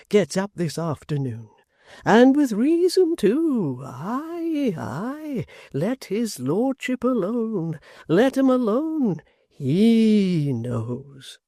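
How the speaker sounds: put-on a slow, high, quavering old man relishing his gossip. A narrator's reading. Old man telling Barnaby about the crowd, slow and high. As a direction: creak it out like an old man leaning on a stick.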